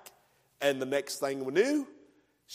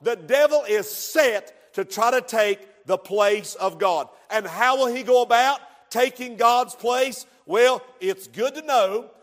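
loud, driving pulpit preaching; fiery revival preacher building his point; preaching at full force; 5 semitones higher, 7 dB louder than usual. Acted. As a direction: hammer each repeated line home to the congregation.

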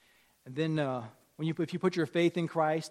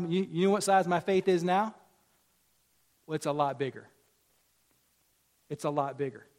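ordinary talk

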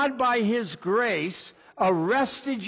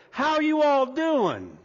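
put-on Southern accent; 6 semitones higher, 3 dB louder than usual. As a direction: greet them in a broad Southern US accent. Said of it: "you're from down south".

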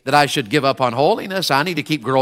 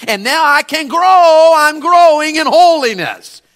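preaching at full force; 10 semitones higher, 5 dB louder than usual. Real, not acted. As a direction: declare it to the church. Preaching climax.

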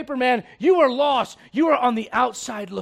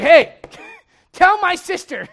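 higher, louder, slow bossy laughing plea; mock Martha demanding; 5 semitones higher, 7 dB louder than usual. Preacher acting Martha to the congregation, laughing mock demand. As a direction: boss the Lord around with a laugh. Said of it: "and be like".

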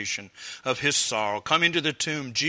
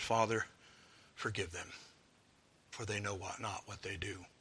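soft, much quieter plea for forgiveness; hushed prayer; 4 semitones lower, 12 dB quieter than usual. Acted. Jesus on the cross asking the Father to forgive, hushed. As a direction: pray it gently, almost under the breath.

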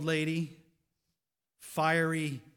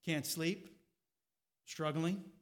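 usual speaking voice

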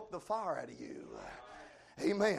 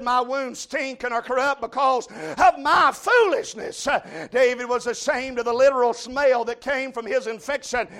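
loud, rhythmic revival sermon; full-force preaching; 6 semitones higher, 11 dB louder than usual. Real, not acted. Preacher telling the congregation that sin stinks to God, full-force preaching. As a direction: drive each phrase out with pulpit force.

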